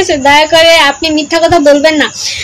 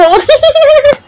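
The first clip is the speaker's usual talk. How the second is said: high, squealing laugh; burst of laughter; 10 semitones higher than usual. Real, not acted. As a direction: crack up at the comment. Laughing at a comment.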